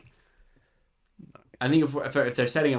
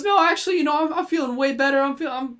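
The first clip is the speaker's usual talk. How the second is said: much higher, loud, fast put-on polite voice; hammed-up innocent cover voice, words tumbling out; covering up the accent; 12 semitones higher, 5 dB louder than usual. Imitating Jake faking a proper voice to hide his accent. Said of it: "he tried to cover up his accent".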